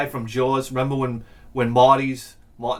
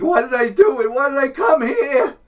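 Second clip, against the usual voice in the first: put-on high, loud wailing kid; hammed-up sobbing child on a ferry; mock crying kid; 10 semitones higher, 5 dB louder than usual. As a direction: wail it like a miserable kid. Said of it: "like, (crying kid on ferry)".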